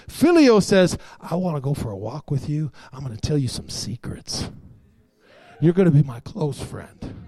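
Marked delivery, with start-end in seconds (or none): 1.2-7.1 low, soft invitation to a close friend; intimate, confiding. Acted. Preacher voicing philio love to a friend, soft and confiding. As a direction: draw them in quietly, voice dropped low.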